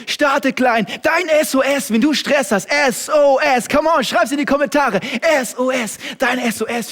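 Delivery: loud, high, slow hammered sermon points; a preacher drilling a slogan at full volume; full-force preaching. Acted. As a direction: shout each point to the congregation.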